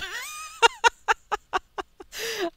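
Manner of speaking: long high burst of laughter. Real, not acted. Player laughing at his own premature announcement, high laugh. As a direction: laugh hard at yourself.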